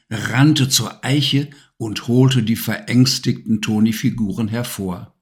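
plain reading voice

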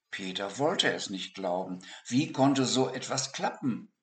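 ordinary delivery, nothing marked

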